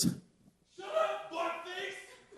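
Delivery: high, strained mock shout of temper; hecka loud yell. Brother turns on the teasing girls, hecka loud yell. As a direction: imitate a boy exploding at his tormentors. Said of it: "Like, hecka loud".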